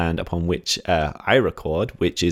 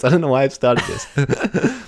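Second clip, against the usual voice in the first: normal talking voice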